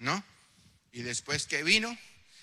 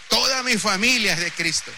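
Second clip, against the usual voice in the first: louder and higher, proclaimed in triumph; triumphant proclamation; 6 semitones higher, 9 dB louder than usual. Shown as a demonstration. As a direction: shout it out as a victory. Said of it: "¿Cuánto le dan aplauso a Dios?".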